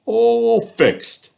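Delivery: high, loud animal voices; proud animals. Acted. Animals presenting the mended toys, high and loud. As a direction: announce the job done proudly.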